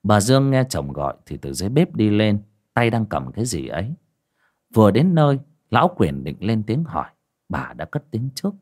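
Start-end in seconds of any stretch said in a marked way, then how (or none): none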